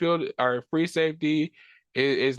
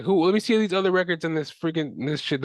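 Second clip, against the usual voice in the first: ordinary delivery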